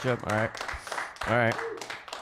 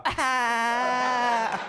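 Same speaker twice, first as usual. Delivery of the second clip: high, sustained "Ah!" held out long; long mock scream. Shown as a demonstration. Woman at the train doors after her insult, imitated scream. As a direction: hold one high screeched note. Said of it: "I'll make that sound".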